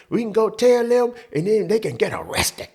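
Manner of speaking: mom's logic. Retold or imitated. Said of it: "That's how she went".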